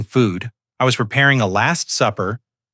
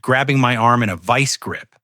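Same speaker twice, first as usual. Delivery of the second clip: ordinary delivery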